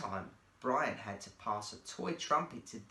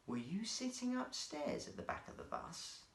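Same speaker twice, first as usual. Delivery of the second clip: softer, hushed question aside; private question off mic; 4 semitones higher, 7 dB quieter than usual. Acted. Bob quietly questions Brian away from the microphone, hushed. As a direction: ask it quietly so the crowd can't hear. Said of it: "moved the microphone to one side so that the audience could not hear".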